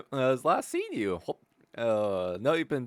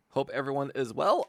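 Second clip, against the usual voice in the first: ordinary talk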